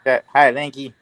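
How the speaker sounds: ordinary talk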